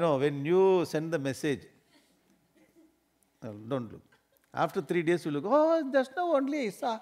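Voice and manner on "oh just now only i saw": high, put-on innocent exclamation; mock innocent excuse. Lying to a friend about a late reply, high mock innocence. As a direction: feign surprise, pitched high. Pretending to have just seen message.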